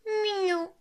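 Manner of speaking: high meow; a high drawn-out meow; cat meow. Acted. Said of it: "miaula le chat".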